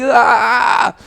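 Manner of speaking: high, loud anguished cry; anguished shout. Retold or imitated. Streamer reliving the loss to viewers, anguished cry. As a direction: let out a pained shout. Outburst after losing the rig.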